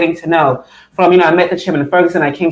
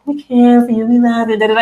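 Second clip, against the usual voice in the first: mock sugary charity voice; 6 semitones higher than usual. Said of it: "This thing of, like".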